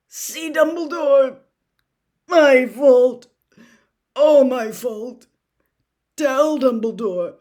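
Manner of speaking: put-on delirious man clutching a boy's knees; desperate raving. A narrator's reading. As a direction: blurt warnings between struggles for breath.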